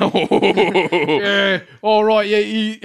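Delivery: loud, high mock Scottish accent; Scottish Twitter accent. Friend attempting Scottish Twitter talk, mock Scottish accent. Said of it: "But not Scottish Twitter accent".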